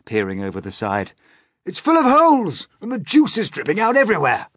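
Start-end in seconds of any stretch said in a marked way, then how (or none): 1.7-4.5 high, loud cry of alarm; old grasshopper yelling in alarm; alarmed shouting. A narrator's reading. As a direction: shout the bad news, high and urgent. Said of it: "shouted the old green grasshopper".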